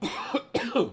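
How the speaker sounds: a hard, hacking cough out of nowhere; sudden coughing fit. Real, not acted. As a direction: cough like a bug went down the throat. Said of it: "felt like I swallowed a bug".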